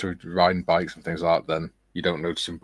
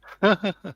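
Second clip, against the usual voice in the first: short high nervous laugh; 13 semitones higher, 3 dB louder than usual. Real, not acted. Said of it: "that was a nervous laugh then".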